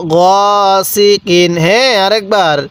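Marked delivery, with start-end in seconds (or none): 0.1-1.5 গ held long and level, then সি কিন; recitation model. Shown as a demonstration. Teacher showing the learner how to read, drawn-out গ সি কিন. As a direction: draw out গ before measured সি কিন. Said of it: "এরকমভাবে শিখব".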